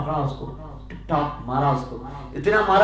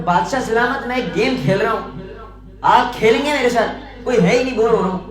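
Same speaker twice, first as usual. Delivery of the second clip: eager madman; 5 semitones higher, 5 dB louder than usual. Acted. Madman invites king to play.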